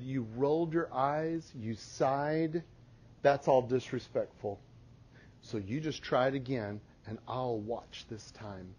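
hushed parental warning; quiet stern warning. Retold or imitated. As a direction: drop to a near hush, firm. Mom quiet firm correction.

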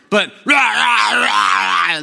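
loud, high mock rah-rah shout; mocking a loud extrovert at a party, shouting; mock cheering. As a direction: imitate a rowdy extrovert.

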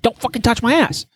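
high, sharp retold warning; warning his wife off, angry shout. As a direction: bark the warning at her. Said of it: "And I was like".

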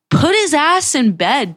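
high, slow, emphatic retold order; exasperated scolding. Girl refusing his friends' excuses, exasperated scolding.

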